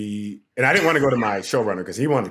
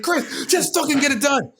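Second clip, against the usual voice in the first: high, exasperated yelled order; retold angry yelling, pitched way up; screaming. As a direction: shout it like a furious boss. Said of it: "We're screaming at each other".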